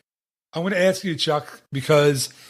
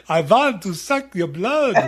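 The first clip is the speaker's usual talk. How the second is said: mock Dracula; 5 semitones higher, slower than usual. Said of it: "Norwegian accent if Count Dracula was from Norway".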